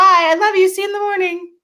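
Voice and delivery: put-on high, sing-out child's goodnight; imitated little boy. Son to mom at bedtime, imitated high child voice. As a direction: call it out high like a kid at bedtime. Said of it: "He's like".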